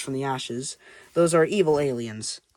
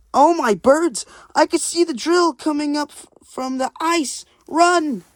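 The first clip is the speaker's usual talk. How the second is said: high, loud panicked cry; panicked penguin; 12 semitones higher, 4 dB louder than usual. Acted. Penguin sees the drill breaking through the ice, panicked warning shout. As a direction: warn everyone in a frightened high voice.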